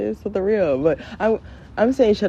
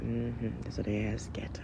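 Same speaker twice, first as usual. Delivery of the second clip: very low, hushed near-whisper; smug muttered aside; 7 semitones lower, 12 dB quieter than usual. Acted. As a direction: gloat under your breath as a parent. Parent's smug aside about the kids.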